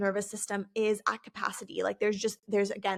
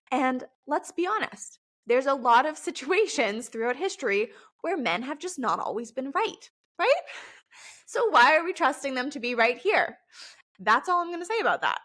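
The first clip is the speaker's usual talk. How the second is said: cheeky, higher and louder dig at men; sassy aside; 4 semitones higher, 3 dB louder than usual. Acted. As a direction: tease it like a pointed joke. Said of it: "No, I'm just kidding".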